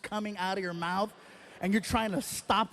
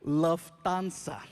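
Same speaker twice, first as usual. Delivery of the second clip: put-on German accent; 4 semitones lower, much slower than usual. Ominous German airline name.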